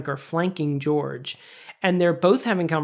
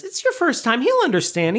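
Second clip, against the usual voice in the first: Kramer quote; 7 semitones higher than usual. Retold or imitated. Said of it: "And Kramer says".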